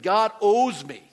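lightly lampoon someone who thinks God owes them; mock entitled whine. Pastor lightly mocking entitled believers, a slow pushed mock whine.

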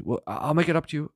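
lightly voiced nervous sinner; mock pleading. Sinner begging God not to destroy him, mildly pleading.

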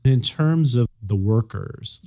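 ordinary talk

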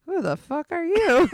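high, incredulous who are you; mock indignant retort. Shocked at a stranger calling her his wife, high and incredulous. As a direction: fire it back in disbelief. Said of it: "and I'm like".

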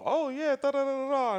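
very high, loud, fake-cheery sitcom voice; Wanda playing it off. Wanda covering for the slip, shrill high fake cheer. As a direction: squeal it like a forced sitcom wife covering up. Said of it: "Wanda tried to play it off".

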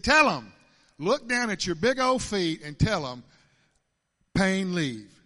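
loud, emphatic order; retold command. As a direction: bark it out like a firm instruction. Retold Lord ordering him to command.

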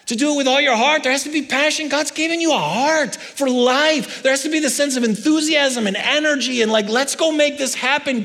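high, loud, fired-up sermon delivery; full-force preaching. Acted. Pastor rallying the congregation to passion, loud and high.

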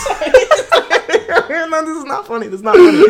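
giggling through the words; trying not to laugh. Real, not acted. Laughing at a headline.

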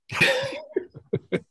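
shrieking high laugh; high burst of laughter. Real, not acted. Laughing at the biscuit tin story, a high burst.

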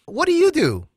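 high, bright-eyed mock question from a stranger; curious stranger. Stranger at Pearl Harbor asking Gary his job, high and eager. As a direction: pitch it way up like a nosy stranger.

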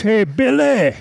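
high retold holler, not full volume; imitated scream. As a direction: imitate calling out to someone far away. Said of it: "He screams across the lobby".

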